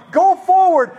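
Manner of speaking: high mock shout from the stands; imitated yelling. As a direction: call it out like a rival spectator. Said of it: "half the people are going".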